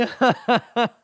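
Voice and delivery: loud, high delighted laugh; overjoyed laughter; giddy laugh. Real, not acted. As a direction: burst out laughing with joy.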